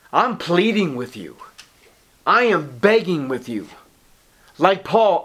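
ordinary delivery

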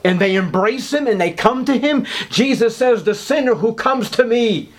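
high, impassioned preaching on the covenant; preacher building to a pitch over the Godhead; rising preaching fervour. Acted. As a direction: preach it up with mounting fire. Preaching climax on coming to Christ.

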